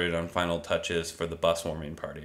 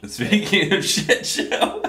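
laughing echo; 12 semitones higher, slower than usual. Retold or imitated.

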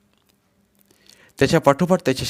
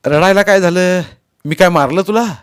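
loud, raised exasperated questions; angry scolding; 8 semitones higher, 9 dB louder than usual. A narrator's reading. Husband to his crying wife, angry scolding. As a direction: round on her impatiently.

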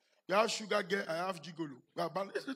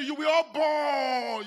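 slow shout; 4 semitones higher, 5 dB louder, much slower than usual. Acted. Preacher rebukes the congregation, slow shout. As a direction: shout it slowly, word by word.

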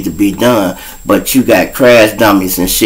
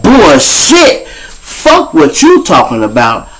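high, loud, then slower defiance; angry retort; 6 semitones higher, 3 dB louder, slower than usual. Retold or imitated. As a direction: throw it back at the cop. Defying the police.

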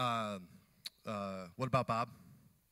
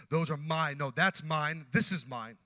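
possessive; 4 semitones higher, 7 dB louder, slower than usual. Acted. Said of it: "everyone's fighting for their own position".

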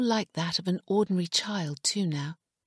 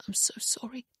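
higher, softer, with a catch in the throat; 6 semitones higher, 5 dB quieter than usual. A narrator's reading. Father apologizing to his sick child, voice catching. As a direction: let the apology break a little. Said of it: "his voice catching".

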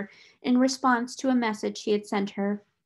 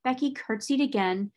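plain reading voice

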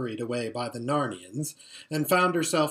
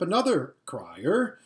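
ordinary delivery, nothing marked

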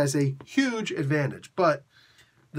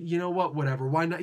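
ordinary talk

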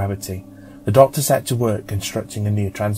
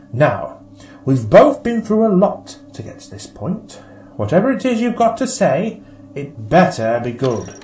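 higher Doctor voice, weary but hopeful; the Doctor, wry; 6 semitones higher than usual. Acted. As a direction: speak to the data stick like an old companion. The Doctor addresses the data stick.

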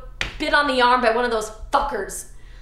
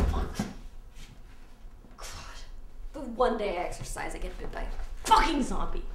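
much softer, dying mutter; weak and hurt; 4 semitones lower, 7 dB quieter than usual. Acted. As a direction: fade out as the bite takes hold. Bitten, weak, groaning.

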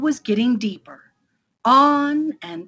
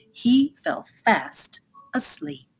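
usual speaking voice

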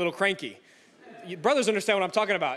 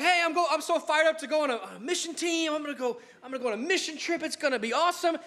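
higher, eager, half-laughing burst; fired-up excitement; 8 semitones higher than usual. Retold or imitated. Preacher imagining himself thrilled to join a mission team. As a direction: play yourself as a hyped new missionary. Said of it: "I'm like".

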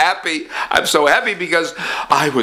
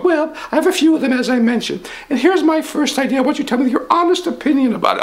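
higher pompous rich man; 10 semitones higher than usual. Acted. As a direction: puff up as the rich boss.

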